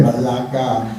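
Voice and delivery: ordinary talk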